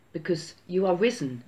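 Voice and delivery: normal talking voice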